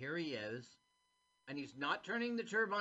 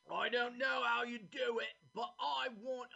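a posh-ish put-on English accent, higher; mock English accent; 9 semitones higher than usual. Mimicking Sir Billy at the dam in an English accent. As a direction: lord of the manor in an English accent. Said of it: "oh, I'm doing England".

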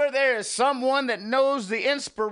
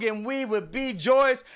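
ordinary delivery

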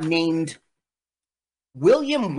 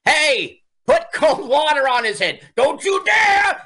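mock angry yell; 11 semitones higher, 5 dB louder than usual. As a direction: yell the order at the crew, then drag out the threat. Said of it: "He goes".

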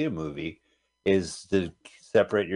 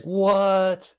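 surprised; 11 semitones higher, 3 dB louder than usual. Retold or imitated. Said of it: "And you're like".

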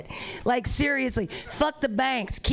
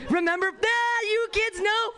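mock old-timer; 9 semitones higher than usual. Old person addressing kids.

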